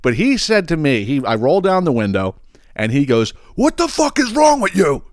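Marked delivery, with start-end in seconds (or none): 3.6-5.1 high, shouted imitation of the angry driver; mock angry yell. Retelling the other driver yelling at him through the window, high mock shout. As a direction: yell it like the furious stranger.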